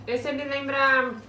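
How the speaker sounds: chewing while talking; speaking between bites; mouth full of chip. Real, not acted. Seen 2: Woman seems to chew after bringing a chip to her mouth; speaker unclear.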